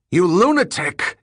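high, loud furious yell. Acted. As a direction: scream it at the worker. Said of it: "yelled the foreman".

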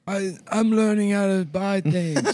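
put-on high, slow, childlike voice; like someone learning basics; slow simple voice. Mocking himself as clueless.

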